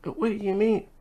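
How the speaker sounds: put-on high confused child voice; little boy puzzled; kid voice.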